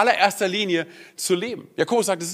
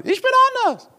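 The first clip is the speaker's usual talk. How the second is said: squeaky high mock voice, much higher; mock proud claim; 3 dB louder than usual. Preacher mocks a churchgoer's excuse in a high squeak. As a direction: parody their smug pride. Mocking a self-justifying person.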